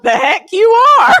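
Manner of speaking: high, loud mock outrage; hammed-up indignant protest; indignant outburst. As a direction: blurt it out in comic disbelief. Acted indignant thought at nasal swab.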